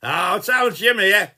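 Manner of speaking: loud, high, jolly hello with a laugh; excited greeting. Real, not acted. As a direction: greet a mate at full volume, pitched high. Hailing Gary at message's start.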